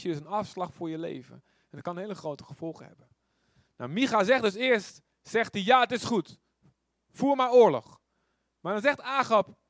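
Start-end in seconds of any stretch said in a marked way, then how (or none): 5.6-8.0 loud, high mocking go-ahead; sarcastic prophet. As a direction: say it with an ironic sneer. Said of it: "misschien zegt Micha het heel sarcastisch". (luid, sarcastisch instemmend)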